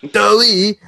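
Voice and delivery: ordinary talk, nothing marked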